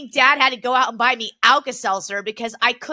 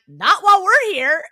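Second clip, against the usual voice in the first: mock dad line, higher and louder; imitating dad; 8 semitones higher, 8 dB louder than usual. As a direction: punch out dad's retort with a grin. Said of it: "He's like".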